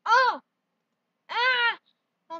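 sudden high, loud yelps of alarm; loud high cries of surprise; startled yelps. Pretend. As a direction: yelp as if jolted. Startled by a transformation.

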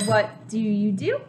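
ordinary delivery

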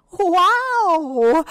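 loud, high, drawn-out wonder; amazed exclamation. A narrator's reading. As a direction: let it burst out high and long. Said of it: "exclaimed Little Hippo".

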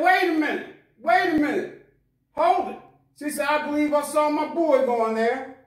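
mother suddenly spotting her son, calling out; excited cry. Acted. Mother spots her lost son.

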